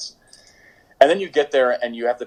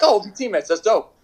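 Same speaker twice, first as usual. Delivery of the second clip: mock Josie excited; 10 semitones higher than usual. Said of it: "And he's like".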